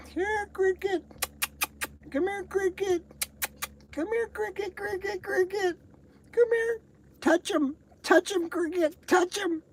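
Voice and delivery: very high sing-song pet call; calling the dog. Acted. Coaxing the dog cricket over, very high sing-song call.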